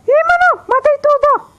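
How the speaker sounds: very high, loud triumphant yell; excited shout. Real, not acted. Gamer celebrating his kills to his friend, a high, loud yell. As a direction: shout the victory.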